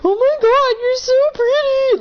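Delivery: put-on high, loud, gushing drunk girl; drunk woman gushing. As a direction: squeal it like a tipsy stranger overwhelmed by your looks. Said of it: "this drunk chick walks up and goes".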